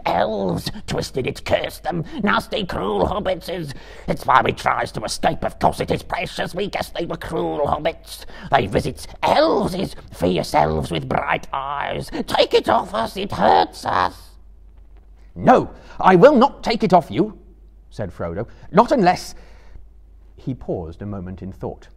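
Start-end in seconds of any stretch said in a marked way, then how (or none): 0.1-14.4 high, loud, tormented creature voice; Gollum hissing. A narrator's reading. Gollum pleads to have the rope removed, hissing. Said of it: "hissed Gollum". 15.3-17.4 loud, high, emphatic refusal; forceful refusal. A narrator's reading. Frodo refuses to untie Gollum, forcefully. 18.7-19.3 loud, high, broken off short; forceful condition left hanging; emphatic. A narrator's reading. Frodo starts a condition for Gollum, emphatic.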